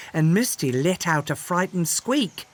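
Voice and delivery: usual speaking voice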